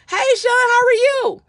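high, loud cheerful hello; greeting a friend at church, high and loud; excited greeting. Pretend. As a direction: call out like you're thrilled to see him.

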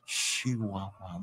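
very slow, low, hushed sultry imitation; sensual and sexy. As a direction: stretch each syllable out seductively. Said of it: "They make it so sensual and sexy".

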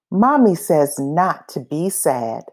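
usual speaking voice

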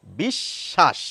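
বিশ্বাস said bishshash, the sh doubled; teacher models বিশ্বাস with a doubled sh; doubled consonant. Shown as a demonstration. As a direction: hold the sh twice in বিশ্বাস. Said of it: "তালব্য শকে কিন্তু আমরা দুবার উচ্চারণ করছি".

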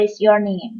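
"What is your name" joined smoothly as whatis-your-name; linked sounds. Shown as a demonstration. Teacher showing students the linked fast form. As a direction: run "What is" together into one flow. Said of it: "เชื่อมกันก็กลายเป็น What is".

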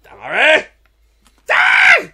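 high, loud screamed battle cry; mock battle cry. Friends replaying a game fight, screaming the battle cry. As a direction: shriek it like a fighter attacking.

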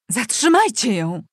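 furious cry to stop a runaway; screamed command. A narrator's reading. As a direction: yell an order to seize her. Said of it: "wrzasnęła Leonora Krystyna".

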